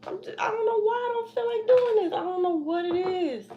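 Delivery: a high, fretful plea of not knowing why; anxious whine. Real, not acted. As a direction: let the worry lift the voice high. Anxious confession on camera.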